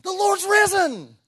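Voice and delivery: high, loud, slow triumphant cry; excited proclamation. Shown as a demonstration. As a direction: shout it out like big news. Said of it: "You'd think she would have said that".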